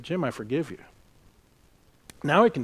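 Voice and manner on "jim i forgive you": quiet. Retold or imitated. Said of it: "he said".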